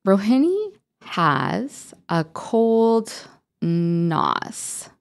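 slow, careful reading aloud; slow sounding out. Shown as a demonstration. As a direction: read it slowly, like a beginner. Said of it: "For example".

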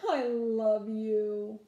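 put-on slow, tender little-boy voice; sweet. A narrator's reading. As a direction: say it sweetly to win her back. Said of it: "he says".

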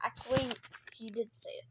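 whispered aside. Real, not acted. Whispering an aside to the others. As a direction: drop to a whisper.

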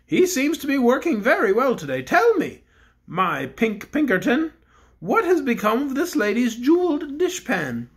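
the Bear King addresses the cook; kindly Bear King. Acted. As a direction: speak as a gentle storybook king. King asks pink bear.

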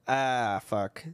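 ordinary talk, nothing marked

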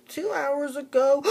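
very high, loud shocked gasp; dramatic gasp. Acted. Friend Tangerine hearing the news, loud high gasp. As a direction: gasp like it's a scandal.

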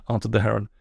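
ordinary delivery, nothing marked

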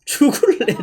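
high teasing threat with laugh; laughing mock threat. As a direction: threaten jokingly through laughter.

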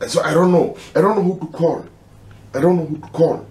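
high, strained and helpless; real anguish, pitch rising; distressed. Real, not acted. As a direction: near tears, lost for answers. Distressed, unsure who to call.

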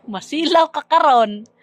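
high, loud teasing with stress on karon. Real, not acted. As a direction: tease loudly, punch karon. Mocking repeat, loud and high.

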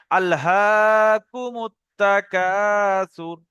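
very slow, every sound drawn out; careful correct recitation. Shown as a demonstration. Teacher shows students the right way, slow careful recitation. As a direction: recite it slowly and precisely, as a model to copy. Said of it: "এরকম শুদ্ধভাবে".

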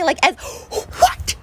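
very high, tearful disbelief; shocked. Real, not acted. Vlogger denying she ever said one bad thing, shocked high cry. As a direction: gasp it out, voice shooting up near tears. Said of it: "I'm so shocked".

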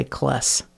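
"kless" with an eh vowel for class; demonstrated Cincinnati vowel in "kless"; Cincinnati short A. Shown as a demonstration. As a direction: say "kless" to rhyme with bless. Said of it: "short A's".

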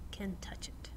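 soft unvoiced whisper. Preacher to congregation, hushing to a whisper. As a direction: breathe the words like a secret. Dramatic hushed end.

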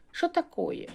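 put-on «Шо такое» said with a Ukrainian accent, pitched high; light Ukrainian accent on Russian words; playful Ukrainian-accented Russian. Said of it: "російською з українським акцентом".

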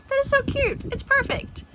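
gushing delight. Real, not acted. Gushing over porch decoration.

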